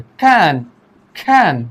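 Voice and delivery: can stretched out alone, as the first half of cunning; first syllable isolated. Shown as a demonstration. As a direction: hold can by itself, then stop. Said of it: "इसमें कितने syllable". (can को अलग से खींचकर बोलें)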